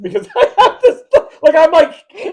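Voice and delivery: high and loud, words squeezed out through laughter; laughing hard. Real, not acted. Picturing herself hitchhiking with her bag, laughing high and loud. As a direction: barely get it out for laughing.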